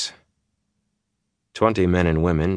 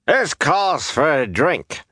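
high, slow, drawn-out proclamation; hearty called-out announcement, stretched out; announcing; 10 semitones higher, slower than usual. A narrator's reading. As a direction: proclaim it to the whole crowd. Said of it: "old Billy announced".

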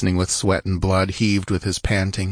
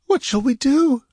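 whimpering. A narrator's reading. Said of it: "she whimpered".

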